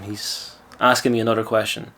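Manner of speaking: ordinary talk, nothing marked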